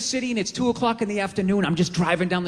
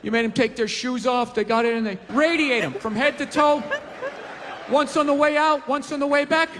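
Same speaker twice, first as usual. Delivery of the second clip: higher eager henchman voice; 6 semitones higher than usual. Acted. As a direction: pitch it up, eager to please. Excited henchman suggestion.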